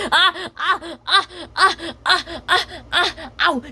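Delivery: high, loud pained yelping; pained yelps. Acted. Cat yelping high as the rat scratches her face. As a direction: yelp as the claws hit.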